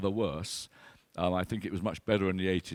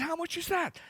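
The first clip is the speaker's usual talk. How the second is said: surprised. Retold or imitated. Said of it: "I said".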